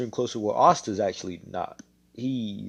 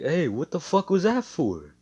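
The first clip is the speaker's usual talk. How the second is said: outraged, higher and louder; indignant yell; 9 semitones higher, 6 dB louder than usual. Acted. Mars to Asta after being drop kicked, indignant yell. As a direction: yell it right after the kick.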